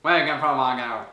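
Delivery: low garbled English; unfriendly American clerk. Acted. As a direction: mumble mock English like a surly clerk. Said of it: "aimable qu'il se faisait même pas chier à essayer de parler". (employé américain pas aimable)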